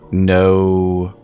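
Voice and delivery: ordinary delivery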